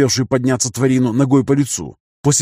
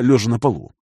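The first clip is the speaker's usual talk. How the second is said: ordinary delivery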